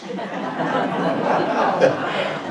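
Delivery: quiet laugh at his own joke; soft breathy laugh. Real, not acted. Comic laughing softly after his punchline, breathy chuckle.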